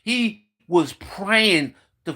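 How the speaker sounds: ordinary delivery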